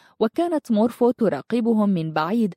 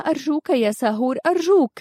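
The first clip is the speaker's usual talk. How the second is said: high-pitched begging that climbs on the repeat; insistent pleading; 7 semitones higher, faster than usual. A narrator's reading. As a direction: press him with an urgent entreaty. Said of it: "ألحت منارش عليه قائلة". (بإلحاح ورجاء شديد)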